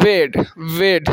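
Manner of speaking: वेड said slowly twice with a clear e vowel; slow model word वेड; slow demo of bed. Shown as a demonstration. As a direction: drag out वेड so the e sound is heard. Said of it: "ए का उच्चारण हो रहा है".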